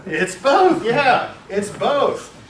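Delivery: high, loud and triumphant; emphatic answer. Real, not acted. Teacher answering his own question to the class, loud and emphatic.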